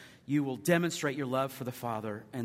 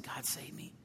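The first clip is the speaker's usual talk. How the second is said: softer, breathless whisper of a plea; 12 dB quieter than usual. Retold or imitated. Retelling his prayer to God, whispered.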